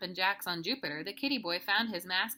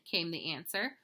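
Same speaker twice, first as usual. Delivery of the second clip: usual speaking voice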